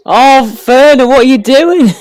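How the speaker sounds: loud, high cry of frustration; exasperated shout. Real, not acted. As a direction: yell at the player on screen. Reacting to a miss.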